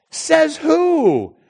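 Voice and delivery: high, loud rhetorical challenge. Acted. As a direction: call them out. Challenging retort.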